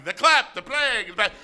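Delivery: high, hammed-up jumbled cries; mock panicked crowd. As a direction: cry out like a confused, panicking crowd. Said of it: "people were going".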